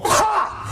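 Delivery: explosive, high cathartic "ha"; demonstrated release yell; forceful "ha" shout. Shown as a demonstration. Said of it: "bring it down again and go".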